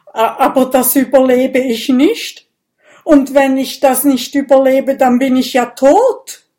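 loud, high, stammering start; panicked protest. Acted. Dragon fearing five litres will kill him, panicked. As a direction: protest as if life depends on it. Dragon fears dying from blood loss.

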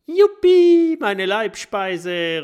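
very high, loud joyful whoop; thrilled at his favourite food. A narrator's reading. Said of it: "ruft Minimo".

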